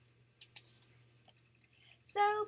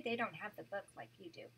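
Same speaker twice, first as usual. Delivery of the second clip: ordinary delivery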